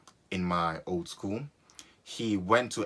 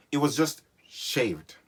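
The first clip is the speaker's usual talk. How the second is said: slow disbelief; 5 semitones higher, 4 dB louder, slower than usual. Acted. Dramatic disbelief.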